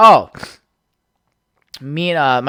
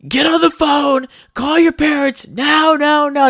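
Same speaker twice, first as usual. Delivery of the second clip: high, slow barked orders; mock drill sergeant shouting orders; drill sergeant yelling; 12 semitones higher, slower than usual. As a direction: yell like a drill sergeant at recruits. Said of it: "just, like, yelling at us".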